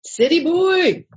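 put-on high, slow, drawn-out repeat of City boy; playful echo. Host teases the guest about being a city boy, echoing high.

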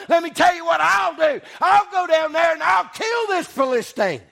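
rising from fervent to a high shout; building preaching. Acted. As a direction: climb to a shouted climax.

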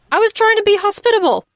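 high, quick mock protest; defensive protest. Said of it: "Lumiere's only defense is".

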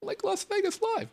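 hyped announcer. Shown as a demonstration. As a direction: announce it with big hype. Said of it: "repeats title".

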